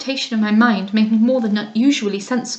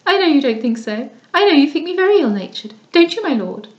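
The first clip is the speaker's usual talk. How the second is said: put-on affected young lady; 5 semitones higher than usual. Lady Louisa complains to Mrs Beaumont about the dust, affectedly high. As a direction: whine about the dust like a pampered girl.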